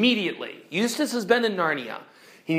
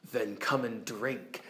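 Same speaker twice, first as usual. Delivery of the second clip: lower, much softer, solemn lion; calm lion; 7 semitones lower, 11 dB quieter than usual. Acted. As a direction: answer gently but gravely. Lion invites Jill.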